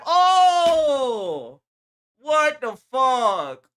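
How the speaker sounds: slow, stretched-out shocked cry; shocked shout. Real, not acted. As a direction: blurt it out in disbelief. Reacting to the clip.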